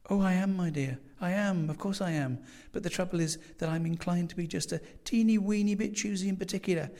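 put-on a fussy old landlady, eager to please. A narrator's reading. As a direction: coo like a doting old landlady. Landlady voiced by narrator.

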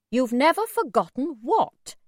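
stern, demanding question. A narrator's reading. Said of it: "my mother asked sternly".

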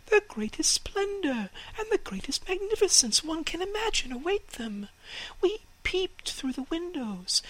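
very high, breathy chattering bird voice; chattering sparrows sharing a secret; gossiping sparrows. Acted. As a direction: tell the secret like excited little birds. Sparrows describe the town.

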